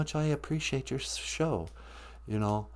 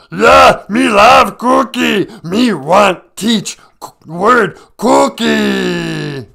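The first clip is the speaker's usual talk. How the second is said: much higher shouted slow monster growl; Cookie Monster; 9 semitones higher, 12 dB louder, much slower than usual. Acted. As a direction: bellow for cookies like a ravenous monster. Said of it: "it's Cookie Monster".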